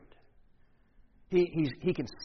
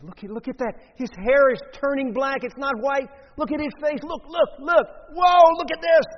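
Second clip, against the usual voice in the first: much higher and louder, exclaiming in wonder; astonished excitement; 10 semitones higher, 11 dB louder than usual. Acted. Leper shouting to companions at their healing, amazed. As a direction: cry out in disbelief at a miracle.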